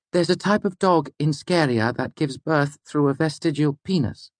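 high-pitched student voice; Inissa. Acted. Frustrated student trying another fact on her lecturer, high-pitched.

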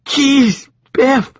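high, loud appalled cry; high appalled exclamation; Joshua, appalled. A narrator's reading. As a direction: blurt it out in shock. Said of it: "Joshua stuttered".

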